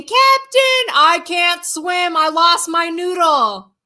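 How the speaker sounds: high, drawn-out mock wail; exaggerated plea of a panicked man; imitated crying wail. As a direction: whine it slowly, as if helpless. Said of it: "this scream and this cry".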